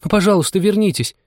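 high, pleading call; calling after. A narrator's reading. Anya begging the departing mouse to return, calling out. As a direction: call out and beg them back. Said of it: "вслед ей крикнула Аня".

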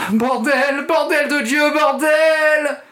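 very high, loud screams of pain; agonised scream. Acted. Kid bitten in the crotch, very high agonised screaming. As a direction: shriek it in agony.

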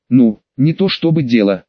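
usual speaking voice

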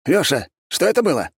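high-pitched, startled question; alarmed friend. A narrator's reading. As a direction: burst in demanding to know what happened. Friend bursts in after explosion.

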